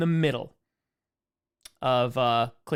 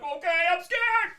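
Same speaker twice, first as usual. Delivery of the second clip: voicing the dog; 12 semitones higher, 4 dB louder than usual. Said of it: "It's like".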